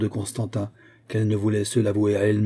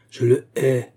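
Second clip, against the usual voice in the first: louder, stamping declaration of hate; angry repetition; 3 semitones higher, 3 dB louder, slower than usual. A narrator's reading. Olga repeats her hatred stamping her foot, more furiously. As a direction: hammer out the hatred. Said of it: "répéta Olga en frappant du pied".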